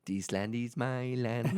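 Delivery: put-on singing it slowly with a Mexican accent. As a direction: sing it in a slow Mexican accent. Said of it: "you doing a Mexican voice".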